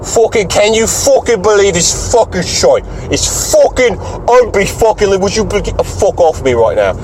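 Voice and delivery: high-pitched irate cursing fit; worked-up re-enacted rant. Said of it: "Just cursing".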